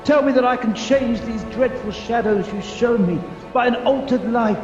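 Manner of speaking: urgent, impassioned begging; frantic, forceful plea; desperate pleading. Acted. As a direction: beg for your life with rising insistence. Pleads with the spirit for mercy.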